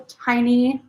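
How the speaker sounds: ordinary talk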